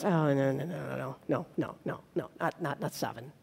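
dropping to an almost hushed, amused no; soft chuckling no. Retold or imitated. Jesus gently dismissing Peter's offer of seven, hushed and amused.

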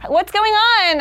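high-pitched uptalk with "on" swung upward; exaggerated questioning lilt on "going on"; everything a question. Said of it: "every single thing was a question".

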